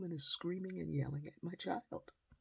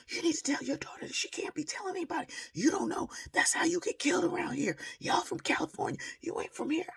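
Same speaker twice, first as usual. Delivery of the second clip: high, fast imitation of her threats; mock ranting woman; 4 dB louder, faster than usual.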